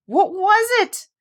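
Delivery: high and slow, startled doll asking; high startled question. Acted. Raggedy Andy asks Fido what crashed in, high and startled. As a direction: squeak it out in alarm.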